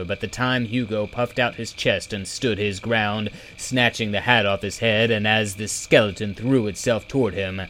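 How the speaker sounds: plain reading voice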